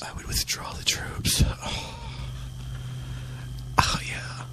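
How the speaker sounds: soft breathy whisper with moans. Acted. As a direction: whisper distractedly, half moaning. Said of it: "I don't know what that is in the background".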